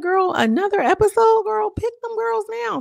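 loud, very high, impatient yell; exasperated shout. Real, not acted. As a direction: beg the show to hurry up.

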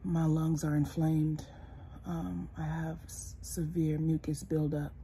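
normal talking voice

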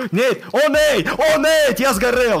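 high, loud frustrated yell; raging gamer. Real, not acted. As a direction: rage at the game. Raging at in-game setback.

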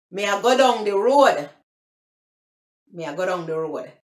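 Jamaican patois, "down" said like "dong". Shown as a demonstration. Vlogger demonstrating phrase number two, Jamaican patois. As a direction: say it in broken-English Jamaican patois. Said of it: "is the broken English, patois".

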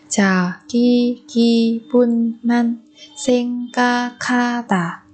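"자기 기분만 생각하다" slowly, syllable by syllable; drawn-out teaching model of "자기 기분만 생각하다". Shown as a demonstration. Teacher repeats phrase syllable by syllable.

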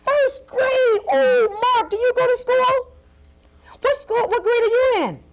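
very high, gushing clown voice; excited clown host. Acted. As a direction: marvel at the little guests in a high voice. Clown host quizzes the children.

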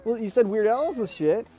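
mock kid comeback; whiny kid comeback. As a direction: whine it back like a smug kid. Brother's comeback, retold.

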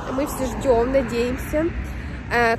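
ordinary delivery

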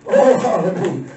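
ordinary talk, nothing marked

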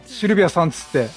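usual speaking voice

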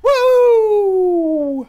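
long, high, loud woo; celebratory whoop. Acted. Cheering at news of breakup.

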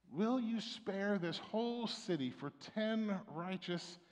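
normal talking voice

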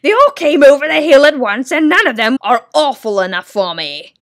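loud booming Din, out of breath; puffing Din. Acted. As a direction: puff it out, exhausted from hauling the sack. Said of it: "he puffed".

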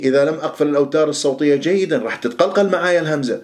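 ordinary talk

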